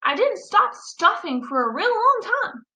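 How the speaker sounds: ordinary delivery, nothing marked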